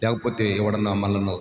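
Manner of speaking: soft, ominous sermon voice; hushed preaching. Acted. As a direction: drop low and soft, building suspense. Hushed dramatic listing.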